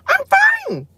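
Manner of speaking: high, strained, fake-bright insisting all is fine; overly chipper, protesting too much; forced cheerful denial. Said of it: "And when you hear this".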